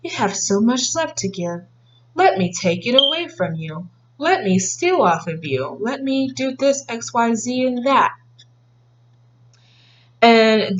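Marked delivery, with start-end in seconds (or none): 0.0-8.1 softer, lightly sly mock vampire; energy vampire. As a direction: coax and take, faintly sly. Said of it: "energy vampires and emotional vampires that are like".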